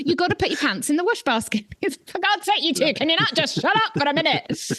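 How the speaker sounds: high-pitched, mock-exasperated telling-off; exasperated scolding. Runner retelling a telling-off of two others, exasperated.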